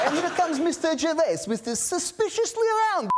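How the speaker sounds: put-on very high, comic Russian accent. Actor teasing his director back in mock Russian accent. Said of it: "why did it have to be Russian".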